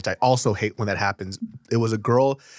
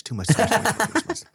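laughing; 10 semitones higher, faster than usual. Real, not acted. Mocking friend's theatrics.

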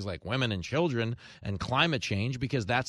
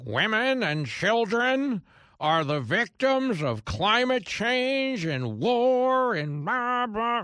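much higher, louder, very slow whiny mock; mocking Hillary; 10 semitones higher, 5 dB louder, much slower than usual. Host parroting Hillary's speech, high slow whiny mock. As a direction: parrot her in a droning whine.

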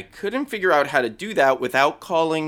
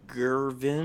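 Gervin, drawn out with a condescending rise; patronizing; 3 semitones lower, 6 dB quieter than usual. Acted. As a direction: say Gervin slowly, talking down. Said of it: "say it like that, 'cause it's very patronizing".

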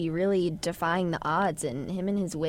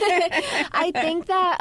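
laughing through the words, pitch jumping higher; 11 semitones higher, 4 dB louder, much slower than usual. Real, not acted. Amused by a question about her co-star, laughing while answering. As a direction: giggle it out, slowed right down.

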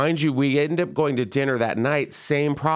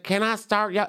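put-on thick Memphis accent; 8 semitones higher than usual. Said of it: "she had a very thick accent".

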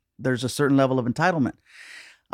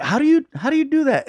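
incredulous; 12 semitones higher, 3 dB louder than usual. Retold or imitated. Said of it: "and I'm like".

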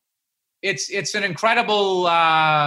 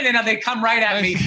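ordinary talk, nothing marked

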